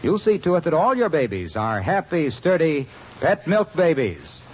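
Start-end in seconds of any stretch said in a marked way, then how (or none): none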